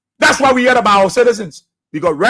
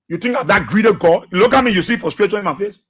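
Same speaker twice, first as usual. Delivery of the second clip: normal talking voice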